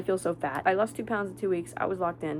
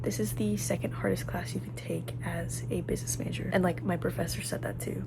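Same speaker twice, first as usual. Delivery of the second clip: stressed whisper in a quiet room; 6 dB quieter than usual. Real, not acted. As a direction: whisper so nobody hears.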